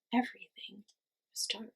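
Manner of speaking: hushed whisper. A narrator's reading. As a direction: breathe it like a secret in the dark. Narrator hushes for the dark.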